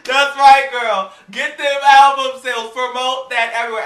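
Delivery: loud hyped-up cheer, a bit slow; hype cheerleader. Retold or imitated. Vlogger hyping herself up about album sales, loud cheer. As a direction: cheer yourself on like a hype girl. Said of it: "I was like".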